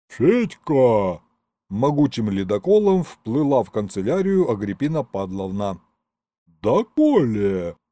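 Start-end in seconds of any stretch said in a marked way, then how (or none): 0.1-1.2 drawn-out indignant call from an imperious woman; imperious accountant. A narrator's reading. 6.6-7.8 slow, drawn-out indignant demand; imperious accountant. A narrator's reading.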